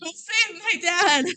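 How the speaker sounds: high, hammed-up plea; mock pleading. Kelly begging her truest friend to save dad, hammed-up. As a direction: plead theatrically in a put-on high voice.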